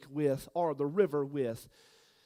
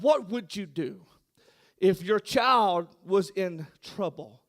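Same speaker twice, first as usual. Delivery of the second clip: ordinary talk, nothing marked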